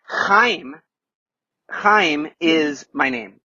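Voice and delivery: slow Chaim with a rasped guttural ch; Chaim with throat sound. Shown as a demonstration. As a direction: scrape the ch from the throat, long vowel. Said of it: "your neighbor has to feel it on their face".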